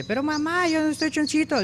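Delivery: put-on very high little-kid piglet voice; put-on squeaky small-pig voice. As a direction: protest to mom in a tiny high voice. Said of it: "le dijo el pequeño".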